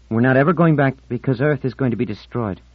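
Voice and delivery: put-on high, loud girl delivering dire news; revealing Marlena. Marlena tells Ornell why they won't return, insistent.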